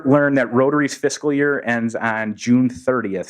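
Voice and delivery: ordinary talk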